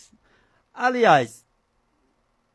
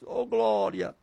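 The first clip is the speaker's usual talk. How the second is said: ordinary talk, nothing marked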